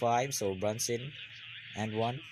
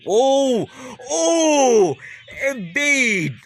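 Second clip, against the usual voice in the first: loud high cries at a big play; excited yell; 12 semitones higher, 9 dB louder, much slower than usual. Real, not acted. Fan watching the game yells out, loud and high.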